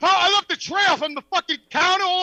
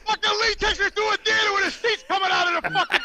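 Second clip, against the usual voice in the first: usual speaking voice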